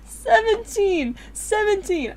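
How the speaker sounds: laughing. Real, not acted. Laughing repeat.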